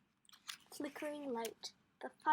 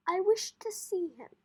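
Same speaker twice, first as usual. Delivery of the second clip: put-on old gentleman, louder, used to obedience; commanding; 5 semitones higher, 11 dB louder than usual. Old gentleman at the farmer's door, commanding. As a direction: demand it firmly.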